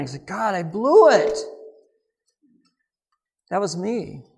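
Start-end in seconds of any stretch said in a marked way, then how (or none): 0.3-1.4 higher, drawn-out wail to God; retold anguished cry. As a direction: cry out in despair, slowly. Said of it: "fell on the floor and started crying".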